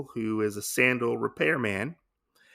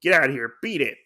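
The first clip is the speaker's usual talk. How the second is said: dismissive, slightly raised order; retold shooing; 4 semitones higher, 6 dB louder, much faster than usual. Innkeeper shooing hungry kids away, mock dismissive. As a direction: shoo someone off in mock annoyance. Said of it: "shoos them away".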